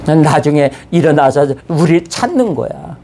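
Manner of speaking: usual speaking voice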